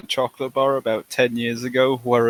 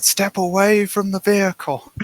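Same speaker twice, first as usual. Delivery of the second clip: higher-pitched mock police order; police helicopter callout; 7 semitones higher than usual. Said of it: "with a helicopter calling out".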